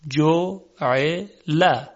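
جعل drawn out, each vowel clear; slowly spelled word. Shown as a demonstration. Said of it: "مثلاً کلمهٔ". (کشیده و شمرده)

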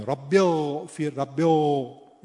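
اغفر with an overdone thick, dark ghayn; wrong, too heavy ghayn. Shown as a demonstration. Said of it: "درشت هم نباید بگیم".

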